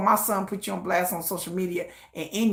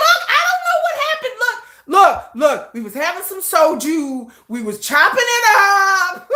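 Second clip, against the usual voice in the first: high, loud brush-off; shameless excuse; 8 semitones higher, 5 dB louder than usual. Retold or imitated. Mother excusing herself to her son, high and loud. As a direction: shrug it off brightly. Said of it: "I'm like".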